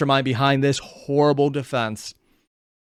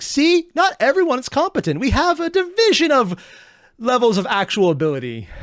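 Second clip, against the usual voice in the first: a much higher, smug, self-assured officer; mock commander voice; 10 semitones higher, 4 dB louder than usual. Mocking the incompetent commander, pitched up and smug. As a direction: play the pompous commander lecturing his troops.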